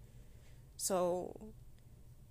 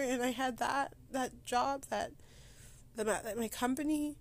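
higher, strained voice near crying; holding back tears; 5 semitones higher than usual. Real, not acted. Podcaster missing her dog, voice near breaking. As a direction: fight the tears while talking about losing everything. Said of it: "I am trying not to cry".

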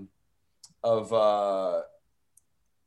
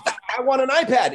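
put-on excited kid; 10 semitones higher than usual. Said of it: "And they'd be like".

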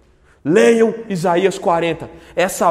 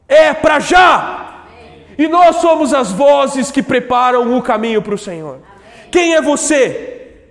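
louder, higher preaching cry, first words dragged out; rousing shout; 5 semitones higher, 3 dB louder than usual. Real, not acted. Pregador exorta a igreja aos gritos. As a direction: proclaim it to the whole church.

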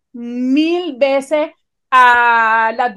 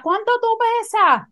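much higher, shrill-pitched mock yell; nurse yelling at full voice; 9 semitones higher than usual. Nurse broadcasts patient's weight question, high mock yell. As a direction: holler it across the emergency room. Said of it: "y ella a toda boca".

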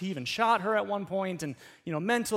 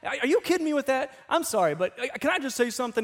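exasperated; 6 semitones higher than usual. Retold or imitated. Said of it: "He said".

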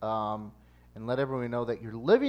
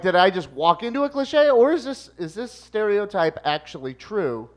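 amazed disbelief; 8 semitones higher, 9 dB louder than usual. Retold or imitated. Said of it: "And I'm like".

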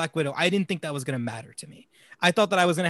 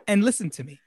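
ordinary delivery

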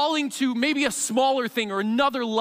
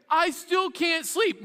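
put-on whiny kid; 8 semitones higher, slower than usual. Child returns yet again.

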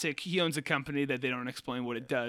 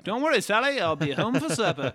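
put-on old-timey announcer; 4 semitones higher, 5 dB louder than usual. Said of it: "an old-timey announcy voice".

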